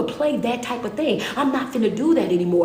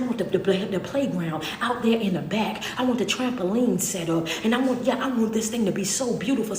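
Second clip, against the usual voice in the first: ordinary delivery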